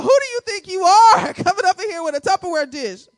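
who-do-you-think-you-are indignation, hammed up; indignant. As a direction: put on outrage, punching it out. Acted affront at a side dish.

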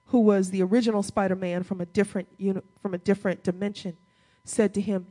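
usual speaking voice

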